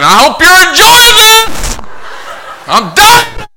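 high, loud mock whine of giving up; fed-up surrender. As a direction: throw up your hands and quit, hammed up. Pinned down, mock surrender to laughers.

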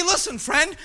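ordinary talk

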